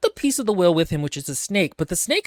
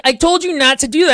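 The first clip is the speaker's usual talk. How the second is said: angry scolding; 10 semitones higher, 7 dB louder, faster than usual. Retold or imitated. Arthur scolds the snake after venom.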